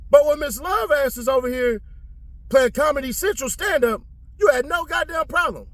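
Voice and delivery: high-pitched, exasperated accusation; indignant call-out. Pretend. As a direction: call out the double standard, voice way up. Calls out the judge's double standard.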